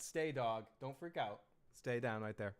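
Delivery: soothing the dog. Real, not acted. Calming the dog.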